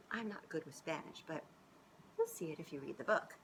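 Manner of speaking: ordinary delivery, nothing marked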